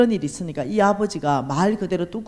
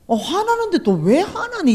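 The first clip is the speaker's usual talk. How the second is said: mock indignant retort; 10 semitones higher, 4 dB louder than usual. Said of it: "이러면 곤란하잖아요".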